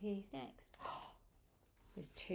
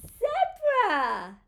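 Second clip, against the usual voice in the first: loud, high cry of delight; high burst falling at the end; excited reveal; 12 semitones higher, 7 dB louder than usual. Acted. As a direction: call the animal out triumphantly. Revealing the next animal.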